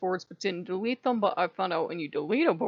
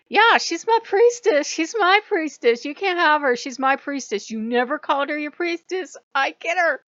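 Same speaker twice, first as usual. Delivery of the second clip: Dionysus voice; 7 semitones higher, 8 dB louder than usual. Retold or imitated. Dionysus butting in, high and loud. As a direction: play a petulant god claiming what's his, pitched up high and loud. Dionysus claiming his priestess.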